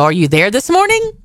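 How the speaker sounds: ordinary talk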